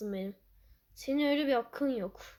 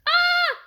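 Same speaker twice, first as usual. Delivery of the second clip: very high, loud scream; shrill scream; 13 dB louder than usual. Real, not acted. Gamer screaming at a shock in the match. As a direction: let out a sudden scream.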